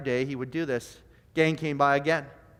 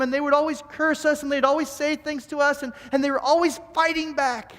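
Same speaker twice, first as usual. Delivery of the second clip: raised pitch, urgent acted outpouring; impassioned confession; 7 semitones higher than usual. A narrator's reading. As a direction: pile up the admissions with mounting intensity. Gang member confesses, building in intensity.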